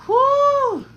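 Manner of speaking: high, loud whoop of disbelief; excited whoop. Real, not acted. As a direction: let out a big whoo. Exclaiming at the thought.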